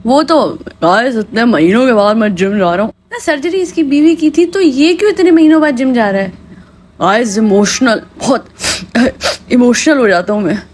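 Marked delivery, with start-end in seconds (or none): 0.7-2.9 a lightly lowered voice aping the husband; mock husband vlogger. Mocking the husband announcing his gym trip, lightly lowered voice. 7.0-10.6 mock emotional husband. Mocking husband's fake emotion.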